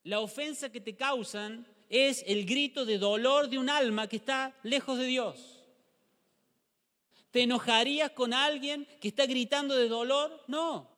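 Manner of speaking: loud, high impassioned preaching; fiery sermon climax; preaching at full force. Acted. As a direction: drive the point home to the church.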